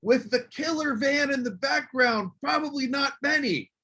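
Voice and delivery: a heckler shouting from the crowd, imitated; lightly voiced shout from the audience; retold heckle. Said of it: "this guy yells out".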